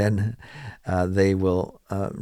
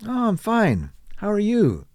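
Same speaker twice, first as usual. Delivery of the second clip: chirpy greeting; 12 semitones higher than usual. Shown as a demonstration. Teacher acting a cheery greeting.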